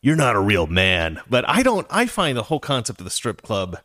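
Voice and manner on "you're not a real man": low mock-macho jeer; lightly voiced taunting guy, pitched down. Guys out there judging him, mocking. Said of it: "guys out there are going".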